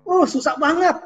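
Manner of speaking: high, loud acted complaint; participant's complaint. Participant struggling with the activity, exaggerated complaint. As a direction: play up how hard the task is.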